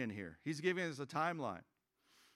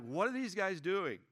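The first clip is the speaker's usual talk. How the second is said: impatient griping; 6 semitones higher than usual. Retold or imitated. Driving past the unfinished Bojangles, mock-exasperated griping. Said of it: "I'm like".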